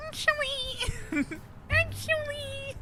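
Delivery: high squeaky slow actually; high slow squeaked actually; nerd squeak. Retold or imitated.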